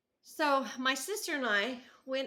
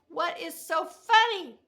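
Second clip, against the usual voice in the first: loud, higher, slow call; louder, slower, pitched up; 8 semitones higher, 14 dB louder, slower than usual. Retold or imitated. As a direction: shout the question across to the car. Calling out to sister.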